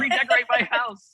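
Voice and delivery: high-pitched, gleeful mock celebration; excited acting-out. Ventriloquist retelling his reaction to redecorating for Christmas, excited. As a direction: gush with overplayed delight. Acts out delight at redecorating request.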